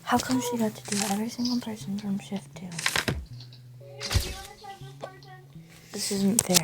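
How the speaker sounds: hushed whispered complaint; whispering. Real, not acted. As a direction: whisper a sulky grumble.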